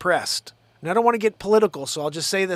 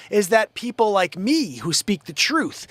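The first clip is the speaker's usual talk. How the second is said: usual speaking voice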